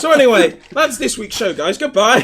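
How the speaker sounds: loud jokey outro through laughter; laughing mock sign-off. Co-host pretending to end the show, loud and laughing. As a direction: boom out a fake goodbye, cracking up.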